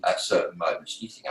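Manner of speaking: normal talking voice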